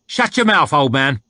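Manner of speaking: loud, high, harsh soldier voice; rough soldier shouting. A narrator's reading. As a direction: bark it down from the saddle. Soldier snaps at old slave.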